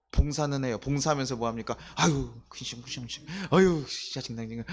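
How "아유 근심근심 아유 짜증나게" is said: grumbling. Pretend. Grumbling churchgoer while serving.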